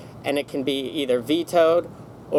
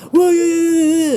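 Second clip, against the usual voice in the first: loud, much higher mocking yell of the cop; mimicking a cop shouting back, loud and high; imitated yell; 9 dB louder, slower than usual. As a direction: throw it out like an angry cop losing it. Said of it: "he yelled and started saying".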